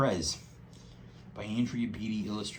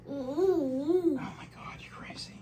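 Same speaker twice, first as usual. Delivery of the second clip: whispering; slower than usual. Real, not acted. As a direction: whisper it in mock amazement. Amazed at baby.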